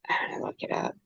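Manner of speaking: whispered aside to herself; hushed note to self while looking it up. Real, not acted. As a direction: barely breathe it, voiceless. Mutters aside while searching.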